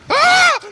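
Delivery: very high loud panicked shriek; terrified scream. Real, not acted. Bird flaps at the man, loud terrified shriek.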